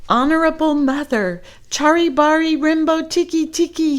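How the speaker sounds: put-on high, loud gasping boy. Chang gasping his plea to his mother, high and loud. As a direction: gasp the name out, nearly out of air. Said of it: "he gasped".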